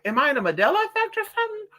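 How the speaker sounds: high, quick, disbelieving wonder; baffled inner thought. Retold or imitated. To herself at the store, high and fast in disbelief. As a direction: ask yourself fast, as if reality glitched. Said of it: "I'd be thinking to myself".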